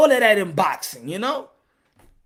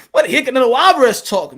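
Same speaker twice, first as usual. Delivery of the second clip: normal talking voice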